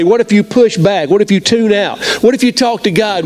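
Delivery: ordinary talk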